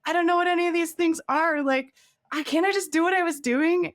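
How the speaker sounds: high, laughing, half-crying plea; tearful pleading. Retold or imitated. New product manager begging her boss, tearful laughing plea. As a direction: replay the panicked, teary protest with a laugh. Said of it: "I'm crying in the meeting, being like".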